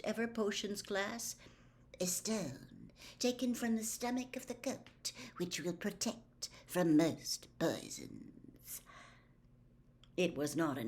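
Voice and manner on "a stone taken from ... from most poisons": softer, slow, lightly voiced Snape; cold, slow teacher. Snape teaching first years, slow, soft and cold. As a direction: drawl it with cold precision.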